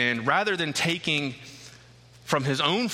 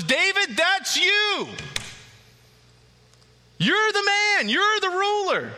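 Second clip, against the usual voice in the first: much higher and louder, slow and accusing; stern rebuke; 4 dB louder, slower than usual. Acted. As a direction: hammer the charge home. Nathan confronting David with his sin.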